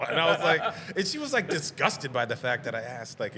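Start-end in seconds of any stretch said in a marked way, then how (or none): none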